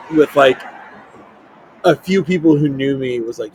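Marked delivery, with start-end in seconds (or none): none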